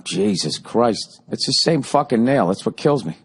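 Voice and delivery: ordinary talk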